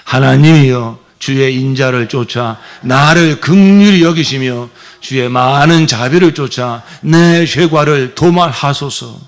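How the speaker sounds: loud, slow reading of the psalm; psalm read out with fervor; scripture recitation. Shown as a demonstration. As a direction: proclaim the verse aloud as a prayer. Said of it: "시작".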